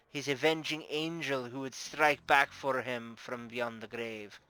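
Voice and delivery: put-on sudden delighted recognition with a Russian accent; amused Russian accent. Bar owner amused by the dead man's avenger, Russian accent. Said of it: "her face brightens, amused".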